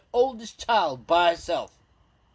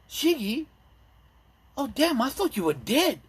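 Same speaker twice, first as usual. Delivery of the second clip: high, startled cry of disbelief; surprised gasp; 9 semitones higher than usual. Retold or imitated. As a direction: react as if seeing a ghost.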